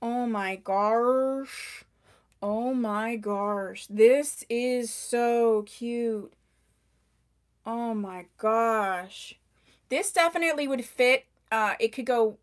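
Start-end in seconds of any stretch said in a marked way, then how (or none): 0.0-9.4 drawn-out, gushing oh my gosh; slow gushing awe. Shown as a demonstration. Seller showing viewers how she'd react to the wig, slow gushing awe. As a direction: linger on each word like you just fell in love. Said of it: "I would have reacted this way".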